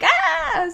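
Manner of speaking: high "ah" of alarm; high, drawn-out mock scream; mock scared cry. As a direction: play-act a startled yelp. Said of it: "I'm just like".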